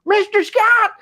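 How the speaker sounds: imitating a cowboy, high-pitched East Texan accent. Cowboy at the back cutting off the speaker, East Texan accent. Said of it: "rich East Texan accent".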